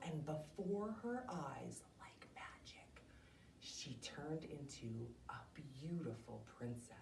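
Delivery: soft, breathy whisper; storyteller whisper. A narrator's reading. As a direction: hush the room for the magic moment. Storyteller's magical transformation moment.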